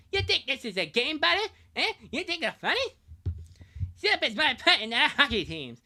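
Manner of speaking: high-pitched, loud Canadian cartoon rant; hammed-up outraged South Park Canadian. Ranger defending syrup as a way of life, South Park Canadian voice. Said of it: "like a Canadian character from South Park".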